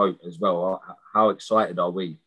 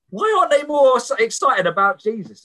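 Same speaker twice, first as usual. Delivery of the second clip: high, loud, eager outburst; a raised, fervent question; imitating excited convert; 12 semitones higher, 5 dB louder than usual. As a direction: burst out like a new believer who can't contain it. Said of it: "he's so excited for Jesus".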